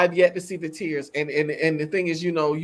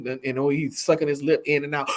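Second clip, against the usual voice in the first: ordinary talk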